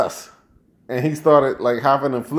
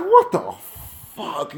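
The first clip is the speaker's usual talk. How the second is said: retold amazement; 2 semitones higher, slower than usual. Retells his amazed reaction.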